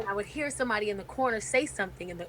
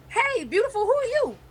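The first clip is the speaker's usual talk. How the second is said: much higher playful come-on; flirty Dave; 13 semitones higher than usual. Retold or imitated. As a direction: hit on her in a sing-song high voice.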